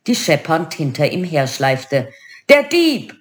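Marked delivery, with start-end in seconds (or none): 2.5-3.1 put-on high, raised outburst in an Arabic accent; indignant genie with Arabic accent; thundering Arabic accent. A narrator's reading. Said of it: "donnerte der Lampengeist mit arabischem Akzent".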